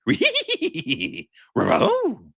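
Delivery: high, slow cartoon dog laugh; wheezy cartoon dog chuckle; Scooby-Doo laugh. Shown as a demonstration. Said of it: "I do the perfect Scooby-Doo voice and laugh".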